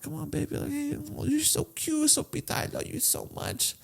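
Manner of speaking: high, very soft doting coo; warm baby talk. Retold or imitated. Reviewer greeting his dog, high soft cooing. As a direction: gush over a pet in a hushed little voice. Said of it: "Come on, where's the warmth".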